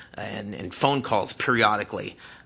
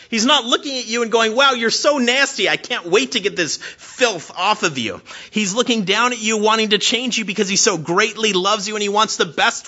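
high, loud, impassioned preaching; fervent pastor at full force; 5 semitones higher, 7 dB louder than usual. Acted. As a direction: preach it out to the whole room.

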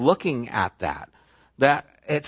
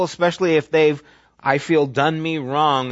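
ordinary delivery

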